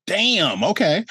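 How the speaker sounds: high, drawn-out amazed reaction; retold amazement; impressed surprise. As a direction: react as if blown away, pitch jumping up.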